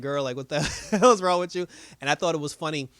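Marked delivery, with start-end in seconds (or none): 0.0-1.7 laughing retort. Retold or imitated. Voices Yolanda's reaction, breaking into laughter.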